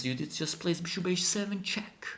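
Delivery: ordinary delivery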